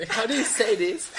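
slow, high Spanglish English. Pretend. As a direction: drawl it out in Spanglish. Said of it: "sigo hablando en Spanglish".

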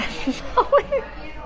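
a sudden airy burst of laughter; breathy high laugh. Real, not acted. [name] laughing after losing her place in the list, real laugh. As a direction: break up laughing mid-list.

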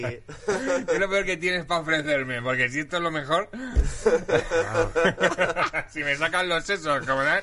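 laughing mock question, high. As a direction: giggle through a cheeky question. Mock asking host for worst food.

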